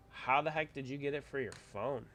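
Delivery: ordinary talk, nothing marked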